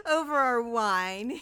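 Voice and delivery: loud, slow, cracking voice of a trapped man; Fortunato's strained jest. A narrator's reading. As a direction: drag it out, half laughing. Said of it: "a sad voice".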